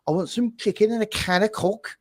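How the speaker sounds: high-pitched imitation Scouse accent; Glimmy Casket's Scouse. Co-host butchering a remembered accent bit, mock Scouse accent. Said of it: "Glimmy Casket when he's talking about accents".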